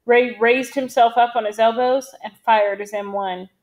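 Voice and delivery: ordinary delivery, nothing marked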